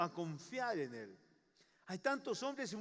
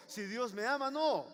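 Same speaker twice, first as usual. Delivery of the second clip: normal talking voice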